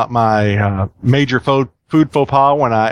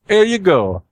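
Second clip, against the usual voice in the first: higher mock-Italian voice; pitched-up cartoon Italian accent; joke Italian accent; 6 semitones higher, slower than usual. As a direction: hand it over in a hammy Italian accent. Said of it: "The Italian stares into space".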